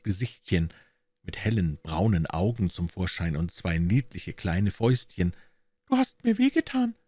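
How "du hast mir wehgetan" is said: put-on high-pitched little girl complaining; squeaky-high little-kid complaint; reproachful child's voice. A narrator's reading. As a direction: play a small child, hurt and reproachful. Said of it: "klagte eine Kinderstimme in vorwurfsvollem Ton".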